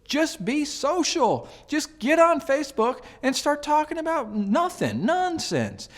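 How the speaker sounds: exasperated retort. Retold or imitated. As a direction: answer them with high-pitched disbelief. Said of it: "And I'm like".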